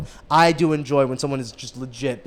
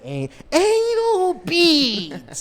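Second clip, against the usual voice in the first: very high, long drawn-out yell; imitated yelling; much slower than usual. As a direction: stretch each word into a soaring holler. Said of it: "he just started yelling".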